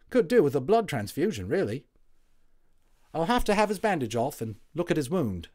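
a WWI orderly waking up chatty; friendly Cockney Tommy, loud and high; cheery Cockney medic. Acted.

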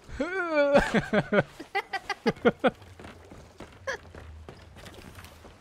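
long, high rising laugh. Real, not acted. Still laughing at the straining.

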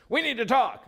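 high, loud blunt demand; mock stern wife demanding a talk; abrupt demand. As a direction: mimic the wife bursting in. Wife's abrupt demand, retold.

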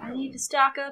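ordinary delivery

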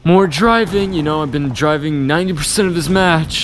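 high, loud exasperated rant; sarcastically not mad. Real, not acted. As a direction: complain loudly, then deny it through obvious annoyance. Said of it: "definitely not mad".

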